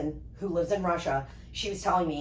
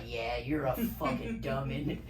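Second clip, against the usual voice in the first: quiet mock insult; 7 semitones lower, 7 dB quieter, slower than usual. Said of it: "I'm just like".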